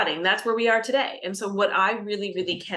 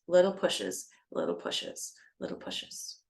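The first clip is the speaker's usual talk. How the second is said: repeated phrase shrinking into a whisper; fading to a whisper; 3 semitones lower, 5 dB quieter than usual. Shown as a demonstration. Describing instinctive small pushes in labor, fading to a whisper. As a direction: start softly and dwindle to bare breath.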